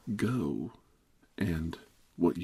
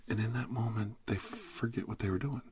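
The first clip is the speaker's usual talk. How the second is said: usual speaking voice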